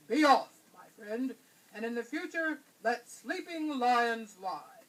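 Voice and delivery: loud lion voice, slow at the end; a proud lion letting his prey go. A narrator's reading. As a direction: send the mouse off with regal calm.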